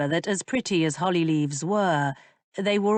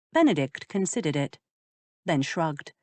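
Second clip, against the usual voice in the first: plain reading voice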